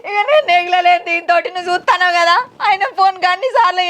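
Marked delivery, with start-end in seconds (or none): none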